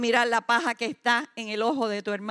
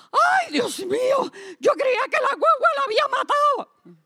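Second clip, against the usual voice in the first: exclamación aguda y mucho más alta, con espanto; señora asustada; 13 semitones higher, 4 dB louder than usual. Retold or imitated. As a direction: grita asustada como quien vio morir a alguien. Señora asustada cuenta el susto. (voz de señora espantada, mucho más aguda y alta)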